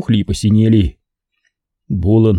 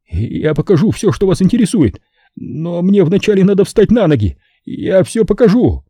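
hurried nervous mumble; 9 semitones higher than usual. Acted. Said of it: "Охрипшим от волнения голосом Саймон торопливо забормотал".

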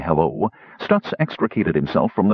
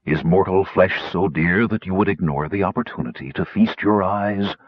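deep, measured mysterious being; low-voiced lofty keeper; soothing bass; 4 semitones lower than usual. A narrator's reading. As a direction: gently chide them in a low voice. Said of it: "said a soothing bass voice".

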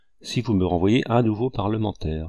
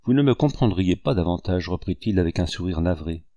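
ordinary delivery

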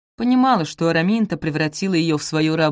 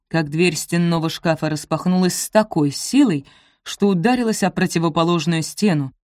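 plain reading voice

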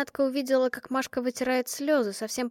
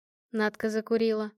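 ordinary delivery

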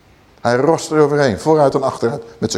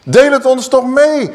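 crowd calling out; 8 semitones higher, 3 dB louder than usual. Retold or imitated. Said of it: "ze beginnen te roepen".

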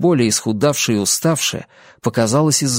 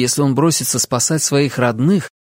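plain reading voice

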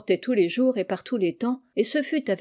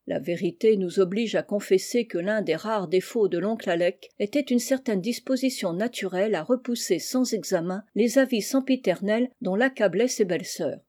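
ordinary delivery, nothing marked

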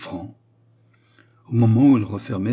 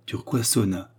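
plain reading voice

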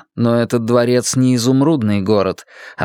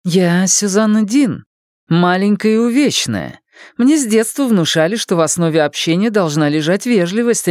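higher, polite woman's voice; Susannah voice; 9 semitones higher than usual. Acted. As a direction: answer with polite dignity.

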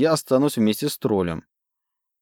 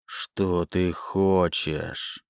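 very slow, low, soft voice from the grave; grim reaper voice; 5 semitones lower, 5 dB quieter, much slower than usual. A narrator's reading. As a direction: sepulchral undead voice. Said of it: "этот замогильный голос".

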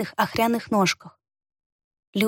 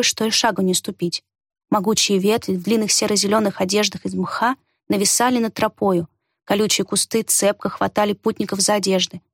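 ordinary delivery

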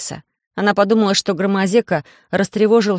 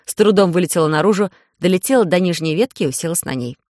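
ordinary delivery, nothing marked

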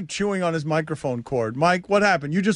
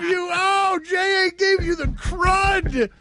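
mock shout; 12 semitones higher than usual. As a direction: drag it out in hammed-up indignation. Mock drug dealer scolding.

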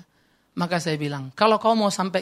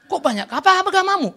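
incredulous questioning; 10 semitones higher, 5 dB louder than usual. Retold or imitated. Acts himself questioning headstone seller.

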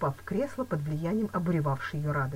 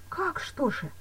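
higher-pitched, surprised echo question; astonished; 10 semitones higher, slower than usual. A narrator's reading. Woman puzzled at his calm, astonished. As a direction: throw the question back in disbelief. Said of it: "удивилась она".

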